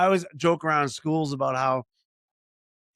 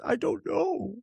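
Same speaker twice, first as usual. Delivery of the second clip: imitating a five-year-old girl, much higher and a bit teary; small daughter's tearful reply; 14 semitones higher, slower than usual. Little daughter caught out by her dad, high teary squeak. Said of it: "you know, crying".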